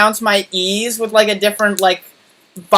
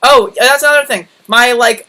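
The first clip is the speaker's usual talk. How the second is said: ordinary delivery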